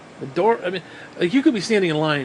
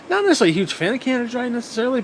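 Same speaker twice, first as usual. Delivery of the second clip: fast dismissive aside; 7 semitones higher, much faster than usual. Real, not acted.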